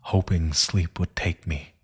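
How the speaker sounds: plain reading voice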